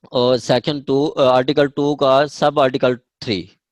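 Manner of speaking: ordinary delivery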